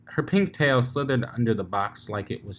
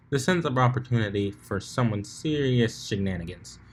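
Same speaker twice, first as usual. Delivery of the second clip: ordinary delivery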